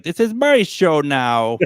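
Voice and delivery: loud, drawn-out put-on voice; Brad impression. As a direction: imitate him slowly and loudly, claiming the show. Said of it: "I think that's how he sounds".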